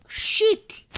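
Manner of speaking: loud high frustrated shout. Real, not acted. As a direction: yell it when you fall. Falls in parkour.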